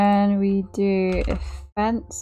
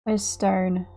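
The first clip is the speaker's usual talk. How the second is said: normal talking voice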